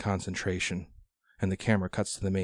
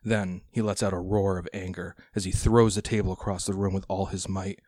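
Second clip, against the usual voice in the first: usual speaking voice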